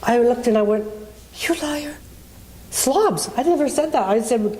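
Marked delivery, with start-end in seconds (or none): 1.4-4.1 higher-pitched, indignant retort; retelling the outraged reply to the bully, much higher; retold indignant outburst. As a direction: fire back at the accusation.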